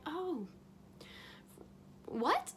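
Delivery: high disbelieving "what"; shocked realization. Retold or imitated. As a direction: catch the shock mid-breath. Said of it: "And I'm like".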